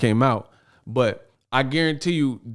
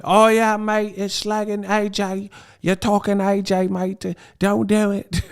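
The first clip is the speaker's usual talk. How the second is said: higher, hammed-up British accent; mock British accent; 9 semitones higher than usual. Host imitating a British fan defending AJ, higher mock British accent. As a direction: put on a cheeky London mate accent. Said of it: "Now the British teeth is coming".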